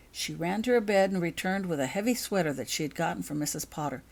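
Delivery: usual speaking voice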